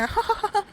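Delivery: high rising giggle; teasing laugh. Acted. Driver giggles at the passenger sliding backwards, high and teasing. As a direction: giggle at her fear.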